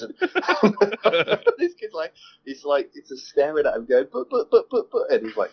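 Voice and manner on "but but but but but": high, flustered stammer; imitating a panicked kid. Kid stammering in retold story.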